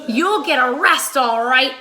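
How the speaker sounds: loud triumphant shout. Acted. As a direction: bellow it as you burst in. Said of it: "shouted Mister Dart".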